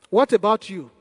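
normal talking voice

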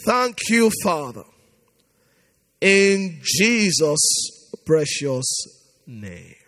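low, slow, hushed prayer; closing prayer. Real, not acted. Preacher closing the vigil prayer, low and hushed. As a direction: bring the prayer down to rest.

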